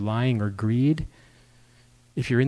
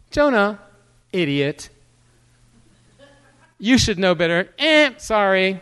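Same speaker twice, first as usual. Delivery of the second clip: a hammed-up, louder rebuke of Jonah; mock scolding; 5 semitones higher, 3 dB louder than usual. Playing God and scolding Jonah, as a mock loud rebuke. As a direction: snap at Jonah, then a sheepish sorry.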